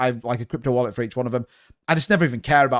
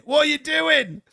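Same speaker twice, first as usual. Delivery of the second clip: much higher, squeaky disbelieving repeat; mock baffled squeal; 12 semitones higher, slower than usual. Baffled quote, second take.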